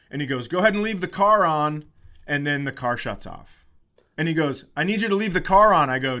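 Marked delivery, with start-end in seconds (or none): 0.5-1.8 louder, higher call across the lot; retold yelling. Rental guy calls to the driver over the crowd, loud. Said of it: "He's yelling 'cause someone else is there". 4.8-5.9 impatient loud order. Retold or imitated. Annoyed attendant repeating an order.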